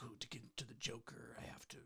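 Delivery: whispered gravelly Batman voice; tortured artist. Retold or imitated. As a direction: hush it like a brooding actor. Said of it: "This is more of a Batman voice".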